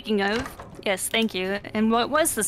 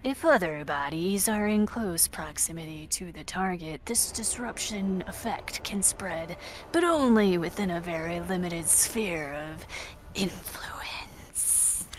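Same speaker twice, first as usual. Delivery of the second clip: spooky whisper; 2 semitones lower, 5 dB quieter than usual. Acted. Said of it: "I won't be spooky anymore".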